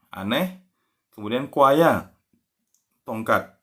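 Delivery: normal talking voice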